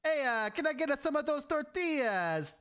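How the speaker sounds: high, drawn-out fake Italian; sing-song cartoon Italian, stretched out; mock Italian accent. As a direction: ham up a bad Italian accent. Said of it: "every accent he does is Italian".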